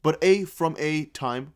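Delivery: slow, baffled retry of the phrase; slow puzzled reading. Shown as a demonstration. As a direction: sound it out slowly, baffled. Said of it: "wait, what, how does that".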